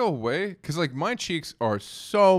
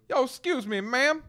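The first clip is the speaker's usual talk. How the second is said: guy on the street; 13 semitones higher than usual. Retold or imitated. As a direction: call out yo excuse me ma'am.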